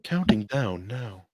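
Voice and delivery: slow robotic monotone; toy robot voice. Pretend. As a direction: copy the phone assistant like a toy robot. Echoing Siri timer, then beeping.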